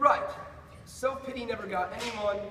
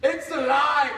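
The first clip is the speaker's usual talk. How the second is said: loud, growing exclamation; mounting triumph; 2 semitones higher, 10 dB louder, slower than usual. Acted. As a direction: call it out as the thrill builds. Creation comes to life, repeated.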